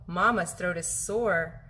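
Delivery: ordinary delivery, nothing marked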